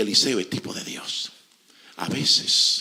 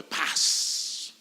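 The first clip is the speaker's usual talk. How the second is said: whispered, breathless "Paz"; hushed peace. Retold or imitated. Grieving mother answers the prophet with a whispered peace. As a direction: breathe the word out calmly.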